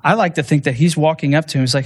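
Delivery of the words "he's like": ordinary talk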